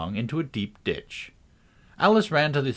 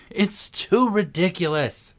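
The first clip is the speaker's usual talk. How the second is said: Alice loses patience, higher and slow; drawn-out impatient protest, pitched up; exasperated cry; 8 semitones higher, 3 dB louder, slower than usual. A narrator's reading. Alice to the fallen knight, exasperated. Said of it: "cried Alice, losing all her patience".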